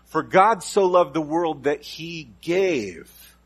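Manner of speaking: slow build landing on gave; stress on gave. Shown as a demonstration. Preacher building the verse toward gave. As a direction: draw it out, land on "gave". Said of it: "You finally get to the word gave".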